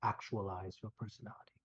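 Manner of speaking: calm kindly mentor, reassuring; gentle wise healer. Acted. NPC speaks gently, removing curse.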